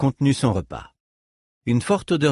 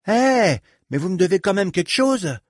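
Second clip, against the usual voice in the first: haut et fort, en protestant; proteste, vexé et aigu; protestation; 9 semitones higher, 3 dB louder than usual. A narrator's reading. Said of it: "protesta Reich". (protestation aiguë)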